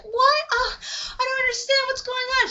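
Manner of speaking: high-pitched acted bewilderment; confused victim. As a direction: play a girl baffled after a blow. Dazed girl voiced after hit.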